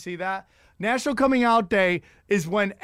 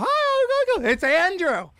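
higher put-on second voice; faked Andrew voice; 10 semitones higher, much slower than usual. Old man fakes the caller's voice for his wife. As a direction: pretend to be someone else on the line.

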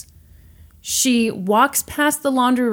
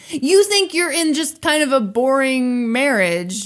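incredulous; 5 semitones higher than usual. Real, not acted. Outraged sympathy with the wife.

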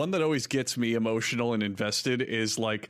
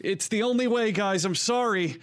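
mock heroic sacrifice; 10 semitones higher than usual. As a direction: play the brave relatable sidekick. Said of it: "and he's like".

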